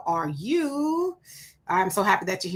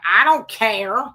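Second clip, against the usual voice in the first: dismissive mock; 6 dB louder, slower than usual. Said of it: "that's kind of been her attitude".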